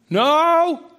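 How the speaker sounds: put-on high, loud drawn-out kid answer; kids' reply. Kids answering dad from their room, high loud call. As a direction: holler back like a kid.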